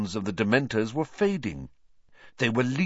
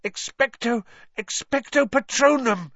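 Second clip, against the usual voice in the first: high, urgent protest building into a spell; plead, then force out the spell; high-pitched panicked cry; desperate gasping; 11 semitones higher than usual. A narrator's reading. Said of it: "Harry gasped".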